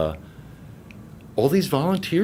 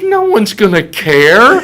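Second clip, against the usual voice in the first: incredulous protest; 9 semitones higher, 7 dB louder, slower than usual. Retold or imitated. Acted protest to excited fan.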